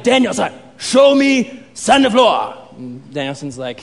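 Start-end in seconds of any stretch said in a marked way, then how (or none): 0.0-2.6 stern sensei impression, slow and raised; Mister Miyagi. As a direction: command the pupil in drawn-out words. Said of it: "But he's like".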